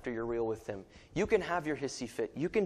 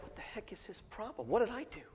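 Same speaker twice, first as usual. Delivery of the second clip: put-on high, hushed aside as the kids; small, high, mock-worried voice; imitating worried kids; 7 semitones higher, 10 dB quieter than usual. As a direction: play bewildered children asking under their breath. Voicing his puzzled kids.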